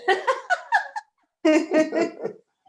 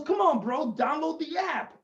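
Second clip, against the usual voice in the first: higher-pitched coaxing bro; 6 semitones higher than usual. Acted. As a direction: urge a buddy, pitched up.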